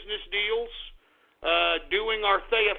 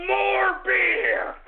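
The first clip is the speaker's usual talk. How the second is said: high, loud yell for more beer; shouted demand; 11 semitones higher, 3 dB louder than usual. Real, not acted. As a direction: bellow it out like a rowdy toast. Calling for beer mid-rant.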